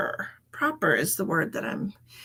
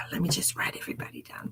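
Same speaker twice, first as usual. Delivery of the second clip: whisper; 8 dB quieter, faster than usual. Real, not acted. Muttering to herself while writing.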